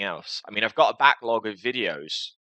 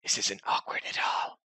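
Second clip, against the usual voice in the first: whispered aside, softer; awkward whisper; 6 dB quieter than usual. Real, not acted. Host to viewers after a long pause, whispered awkward aside.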